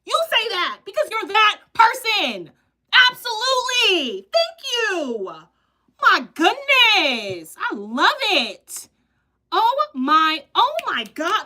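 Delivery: high, loud and delighted; delighted excitement. Real, not acted. Host reacting to a viewer's odd wish, loud delight. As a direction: revel in how funny the wish was.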